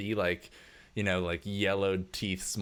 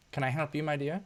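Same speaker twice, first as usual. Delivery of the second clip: lightly voiced snooty socialite, much higher; haughty rich lady; 8 semitones higher than usual. Rich wife answering a worker's tap, haughty and higher. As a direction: look down your nose, sweetly condescending. GM repeats wife's line.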